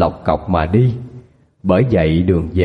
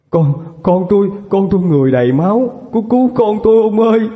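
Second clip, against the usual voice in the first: much higher, frantic wailing plea; mother in panic over her son; hysterical plea; 9 semitones higher than usual. Acted. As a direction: sob out a plea for help. Mother hysterical about bloody son.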